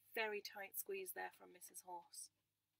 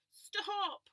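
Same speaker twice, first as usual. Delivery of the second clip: much higher, loud cry; 11 semitones higher, 11 dB louder than usual. Acted. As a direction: shout stop like a squashed lamb. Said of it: "cried Woolly".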